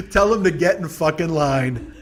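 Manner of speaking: slow retort breaking out of a big laugh; through laughter. Real, not acted. Comic joking about a bored kid, laughing slow retort. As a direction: crack up, then stretch the retort out. Says it while cracking up.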